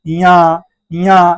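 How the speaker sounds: या drawn out slowly through the nose; nasalised sound. Shown as a demonstration. Teacher showing how to nasalise, slow nasal या. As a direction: push each या into the nose and hold it. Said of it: "थोड़ा सा नाक से नकियाना होगा".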